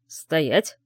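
stern male order; commanding shout. Acted. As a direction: bark the order as the rector. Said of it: "приказным тоном крикнул наш ректор".

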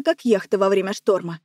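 usual speaking voice

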